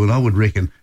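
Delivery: ordinary delivery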